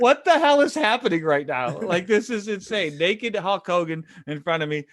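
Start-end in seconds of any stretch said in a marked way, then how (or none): 0.0-3.0 high, loud stunned disbelief. Retold or imitated. Young wrestler meeting naked Hogan, stunned and half laughing. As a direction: blurt it out, half laughing in shock. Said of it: "I'm just like".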